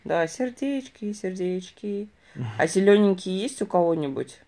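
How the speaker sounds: ordinary talk, nothing marked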